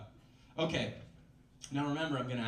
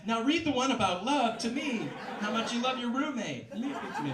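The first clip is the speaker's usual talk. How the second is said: roommate's voice; 9 semitones higher, 5 dB louder than usual. Acted. Clingy roommate voiced high.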